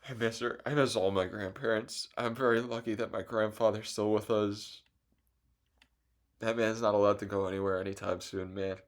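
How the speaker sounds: quiet, choked-up voice, words trailing off; holding back real tears while talking about grandpa; getting emotional. Real, not acted. Missing his late grandma, softly and emotionally. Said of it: "I'm sorry for getting emotional".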